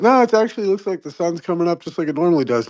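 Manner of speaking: lightly voiced matter-of-fact, fast; mock sensible reply; skeptic. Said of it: "And they're like".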